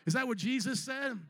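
ordinary talk

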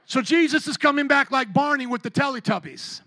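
normal talking voice

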